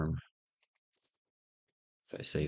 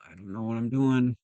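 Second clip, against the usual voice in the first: exasperated; 6 semitones higher, 6 dB louder than usual. Real, not acted. Frustrated coder despairing aloud.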